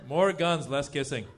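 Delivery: ordinary talk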